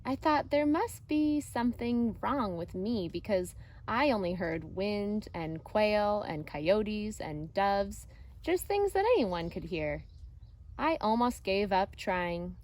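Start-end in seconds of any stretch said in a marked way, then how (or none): none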